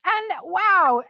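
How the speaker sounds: a loud, surprised wow; genuine wow. Real, not acted. Instructor surprised after her partner's push, a loud wow. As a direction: let the amazement burst out on the wow.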